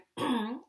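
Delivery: theatrical throat clear. Acted. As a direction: clear the throat like before a show. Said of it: "Frederick cleared his throat".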